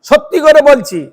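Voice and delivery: ringing assurance to the listeners; emphatic declaration. Shown as a demonstration. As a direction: swear to it with force. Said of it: "মানে জোর দিয়েছে".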